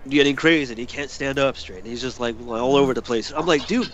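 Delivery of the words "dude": high-pitched mock outrage, breaking into laughter; exasperated retold scolding. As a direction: scold a floppy drunk friend in disbelief. Retelling scolding wobbly drunk friend.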